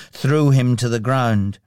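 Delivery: ordinary delivery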